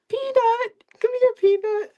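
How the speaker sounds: very high sing-song dog-calling voice; coaxing dog call. Retold or imitated. Narrator coaxes the lost dog behind the fence, high singsong call.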